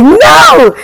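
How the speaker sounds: high, loud yelled no; retold scream of refusal; shouted refusal. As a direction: yell it out in mock terror. Retold refusal atop the platform.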